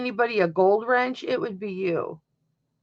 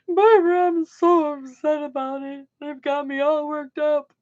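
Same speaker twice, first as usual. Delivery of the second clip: higher, louder put-on whine of distress; hammed-up woe-is-me whimper; mock whiny upset; 6 semitones higher, 4 dB louder than usual. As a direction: ham up being upset, like a pouty child.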